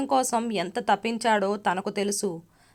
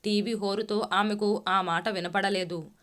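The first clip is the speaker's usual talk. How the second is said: ordinary delivery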